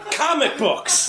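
high-pitched laugh through comic books; laughing punchline. Real, not acted. Comic laughs at the scam caller's fake name, laughing. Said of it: "repeated punchline, first take".

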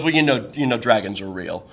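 low, soft matter-of-fact aside; offhand claim. Retold or imitated. Date lets slip an odd belief, offhand and low. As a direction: drop it in casually, sinking at the end.

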